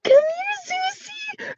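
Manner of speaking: high sing-song coaxing call to a pet. Retold or imitated. Owner coaxing rabbit off the cage, very high sing-song. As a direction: coax him down in a very high voice.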